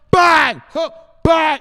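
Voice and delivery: high, loud explosive yell; bang shout. Real, not acted. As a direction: blast it out at full force. Shouting bang for viewer request.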